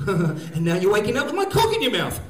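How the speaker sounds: sudden loud burst, rising in pitch; hypnotist's wake-up. Acted. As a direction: jolt the sleeper awake. Hypnotist abruptly wakes the sleeper.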